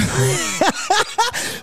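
high burst of laughter. Real, not acted. Host laughing at the flipped quote, high laugh. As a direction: crack up in disbelief.

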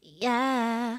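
nasal pop-star "yeah"; imitated pop singer's drawl, in the nose; drawn-out nasal impression. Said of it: "you do a mean [name]".

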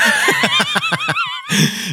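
high shrieking burst of laughter. Real, not acted. Cracks up at her own Maulwurfkuchen boast, shrieking laugh. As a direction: squeal with laughter at your own joke.

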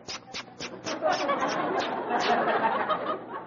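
soft unvoiced giggle; breathless giggle. Real, not acted. Laughs at own rehearsal.

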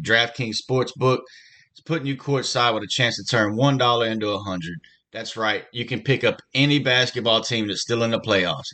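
upbeat sponsor read. Acted. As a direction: sell it like a radio spot. Host reads sportsbook ad.

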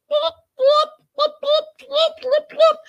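loud squeaky blop noises way up high; lip popping blops. Retold or imitated. Imitating Darcy's alien skit, loud high squeaky lip pops. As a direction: pop the lips like a silly alien.

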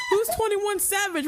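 high shouted confused parent. Retold or imitated. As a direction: yell it like a baffled parent. Parents quoted.

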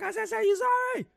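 very high, loud mock yell; hammed-up yell at the rapper on the bike; imitated yell. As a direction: shriek the demand, repeating yourself in anger. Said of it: "He's like".

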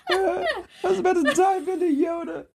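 words squeezed through laughter; cracking up mid-sentence; laughing. Real, not acted. Giggling fit during story banter.

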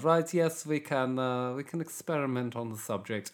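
mock Nazi scientist accent. Comedian playing an evil scientist experimenting on him, Nazi accent. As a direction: put on a hammy Nazi villain accent. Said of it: "that's a Nazi accent".

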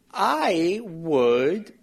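slow, laughing through it; real laughter while saying it. Real, not acted.

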